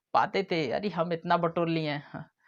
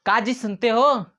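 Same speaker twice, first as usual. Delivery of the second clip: loud, higher call across the room; 6 semitones higher, 6 dB louder than usual. Pretend. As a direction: shout it to someone hard of hearing. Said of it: "एक बार कहो".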